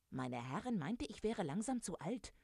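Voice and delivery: high, swooping storybook indignation; mildly outraged, pitch jumping up; indignant rooster. Acted. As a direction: complain like an affronted rooster. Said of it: "empörte sich der Hahn".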